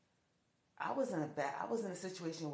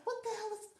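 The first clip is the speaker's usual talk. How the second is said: very high, louder shocked cry; shocked outburst; 4 dB louder than usual. Retold or imitated. Being beaten without reason.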